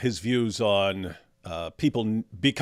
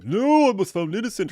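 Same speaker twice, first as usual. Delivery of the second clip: mock denial; 9 semitones higher, 3 dB louder than usual.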